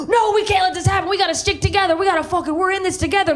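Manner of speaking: high-pitched urgent rallying; rally your girlfriends in a worked-up rush; all jacked up. Acted. Riled woman to her girlfriends, high urgent rallying. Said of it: "trying to get us all jacked up".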